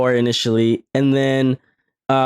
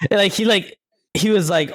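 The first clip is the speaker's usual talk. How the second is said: ordinary talk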